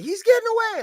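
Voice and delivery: very high, loud panicked call; mock-frantic high shout; imitated alarmed cry. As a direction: shriek it like the worried cashier. Cashier's cry as thief leaves.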